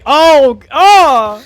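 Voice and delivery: horrified shout. Real, not acted. Recoiling from creepy face.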